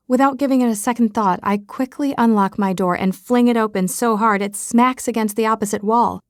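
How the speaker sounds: plain reading voice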